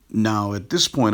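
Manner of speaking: ordinary talk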